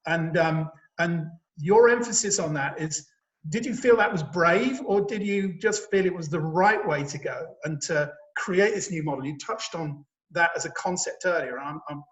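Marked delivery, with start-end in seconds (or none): none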